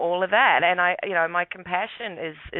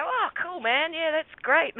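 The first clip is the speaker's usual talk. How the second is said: much higher mock friendly cop voice; 12 semitones higher, slower than usual. Cops chirpily congratulating the son, mock friendly. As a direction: play the cops as chummy and easygoing.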